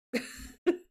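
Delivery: brief breathy chuckle; laugh. Real, not acted. Crafter joking about her shoulders, chuckling.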